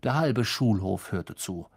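plain reading voice

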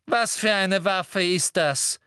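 high, slow, forceful demand; brusque. A narrator's reading. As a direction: demand it sternly, every word weighted. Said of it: "fragte Umbridge schroff".

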